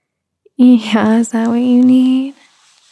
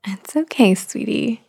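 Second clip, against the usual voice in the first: put-on soothing a child; 4 dB quieter than usual. Comforts hurt child.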